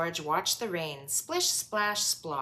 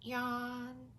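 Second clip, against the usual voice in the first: yawn; 6 semitones higher, 7 dB quieter than usual. Acted. Sleepy parents yawning.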